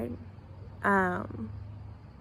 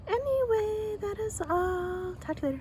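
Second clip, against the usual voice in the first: sing-song goodbye; 12 semitones higher than usual. Real, not acted.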